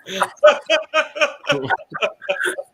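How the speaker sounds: high, sustained laughter; real hearty laugh. Real, not acted. Friends reacting to the dad's bunk punchline, hearty laugh. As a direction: crack up at the joke.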